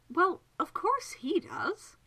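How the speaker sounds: high, drawn-out exclamation; emphatic certainty. Acted. Miss Absalom sees what Childermas feels, exclaiming. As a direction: declare it slowly, as utterly obvious. Absalom states the obvious, exasperated.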